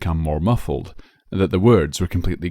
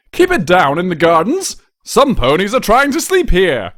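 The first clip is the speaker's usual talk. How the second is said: much higher and louder, irritated royal yell; angry shout; 11 semitones higher, 7 dB louder than usual. A narrator's reading. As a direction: yell at the noisy neighbours through the wall. Prince bangs wall at noise.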